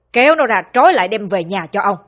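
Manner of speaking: loud, harsh order to the servants; barked command. Acted. Old master orders servants to drag son home, loud command. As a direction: bark it at the household. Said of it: "Ông ta ra lệnh".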